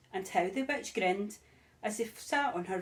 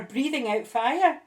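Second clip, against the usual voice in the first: ordinary delivery, nothing marked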